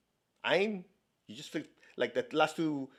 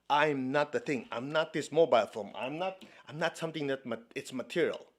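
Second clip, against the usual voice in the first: ordinary delivery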